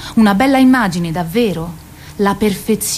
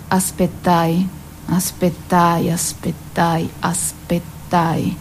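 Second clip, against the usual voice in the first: weary waiting; 3 semitones lower, 5 dB quieter, slower than usual. Acted. Repeated waiting, drawn out.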